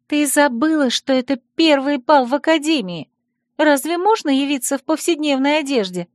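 put-on high girl voice scolding; stern. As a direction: scold her friend in a high voice. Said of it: "сурово спросила она".

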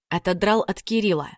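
ordinary delivery

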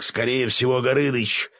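name the threat in a shout; yelled warning. A narrator's reading. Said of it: "заорал мой помощник". (ГОРЫНЫЧ выкриком, высоко)

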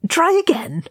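very high, sharp command; snarled. A narrator's reading. Oin ordering Thorin to keep trying, snarling. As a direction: snap the order in a high character voice. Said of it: "Oin snarled".

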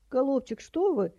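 put-on very high old-lady squeal; high-pitched startled granny. A narrator's reading. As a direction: squeal in protest when grabbed. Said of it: "заверещала тётя Витя".

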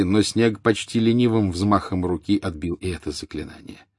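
ordinary delivery, nothing marked

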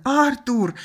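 high, loud cry of a name; distressed shout; alarmed call. A narrator's reading. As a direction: call out to a collapsed brother. Sister calls to her collapsed brother.

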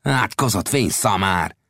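hissed insult; angry hiss. Acted. Gibson cursing Old Death, angry hiss. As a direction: spit the curse out through the teeth. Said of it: "sziszegte Gibson".

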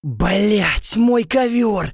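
high outraged blurt. Acted. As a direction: burst out in dismay. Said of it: "выпаливаю я".

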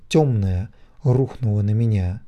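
ordinary delivery

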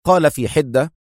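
ordinary delivery

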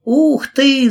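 high, loud cry of delight; excited shout. Acted. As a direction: shout it out in wonder. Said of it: "закричала Кэрри".

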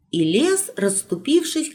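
plain reading voice

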